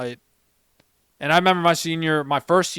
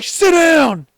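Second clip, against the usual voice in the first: high, loud barked command; drill instructor bark; 12 dB louder than usual. Retold or imitated. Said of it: "And they're like".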